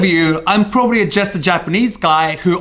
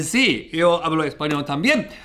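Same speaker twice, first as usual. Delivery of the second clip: a soft, lightly laughing Spanish aside; 4 dB quieter than usual. Shown as a demonstration. Speaking Spanish to viewers, quiet and amused. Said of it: "business with Spanish".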